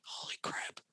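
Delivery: breathless whisper, barely there; stunned whisper. Retold or imitated. Staring at a stern coworker speaking French, a stunned whisper. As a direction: whisper it to yourself, caught out and panicking.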